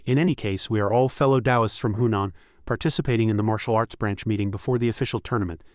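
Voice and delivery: usual speaking voice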